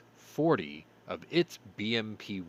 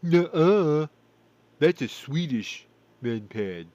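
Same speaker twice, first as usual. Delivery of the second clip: loud sneering nuh-uh from a viewer; louder, higher scoff to mock a pedantic commenter; a pedantic viewer's mocking objection; mock know-it-all viewer; 6 semitones higher, 10 dB louder, slower than usual. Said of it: "gonna look at this and go".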